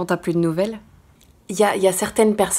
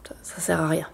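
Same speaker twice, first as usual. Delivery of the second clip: whisper; 3 semitones lower, 8 dB quieter than usual. Real, not acted. Whispered aside.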